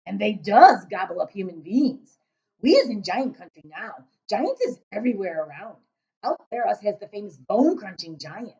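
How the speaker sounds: loud booming giant. Acted. Giant describing giant country to Sophie, booming voice. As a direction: play the big friendly giant, booming.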